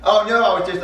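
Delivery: high, loud, hasty excuse; flustered denial. Shown as a demonstration. Junior covers for the rat, flustered. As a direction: deny it quickly in a high voice. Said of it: "And you're like".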